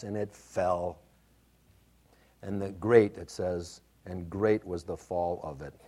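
solemn scripture reading. Acted. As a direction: let the ruin of the house sink in. Reads Matthew seven contrast.

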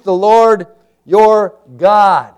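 high, loud, very slow "Lord ... your ... God"; emphatic stress. Real, not acted. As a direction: proclaim "Lord your God" one word at a time. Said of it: "the emphasis".

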